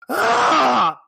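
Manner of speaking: high, loud startled shriek; startled scream. Acted. Candy man caught in the shower, startled high scream. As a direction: scream like you were walked in on. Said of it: "he just looks at you and goes".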